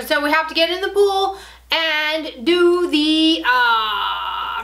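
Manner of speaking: ordinary talk, nothing marked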